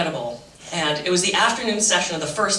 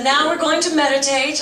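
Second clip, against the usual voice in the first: higher, louder teacher announcement; imitated meditation instructor; 7 semitones higher, 4 dB louder than usual. Instructor tells the room they will meditate, imitated announcement. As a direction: announce it brightly as the instructor, raised pitch.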